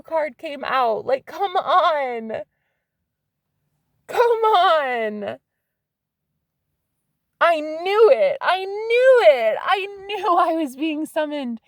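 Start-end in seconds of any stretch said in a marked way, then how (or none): 1.1-11.6 high, stretched-out exclamations; thrilled, incredulous outburst; excited vindication. Real, not acted. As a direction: crow that you were right all along. Vindicated by the card drawn.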